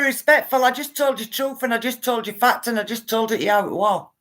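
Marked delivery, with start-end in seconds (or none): none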